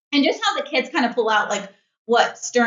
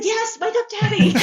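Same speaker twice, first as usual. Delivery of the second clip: high, laughing agreement with a grin; laughing echo; 11 semitones higher than usual. Real, not acted. Mom laughingly backs dad to their daughter, high and amused. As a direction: tease it through a laugh. Mom teasingly echoes dad's offer.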